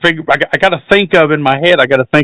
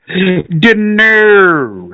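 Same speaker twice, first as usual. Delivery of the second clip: drawn-out Scottish attempt gone cartoonish; botched Scottish accent. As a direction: stretch it out in mock Scottish. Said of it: "Now I'm fucking Austin Powers".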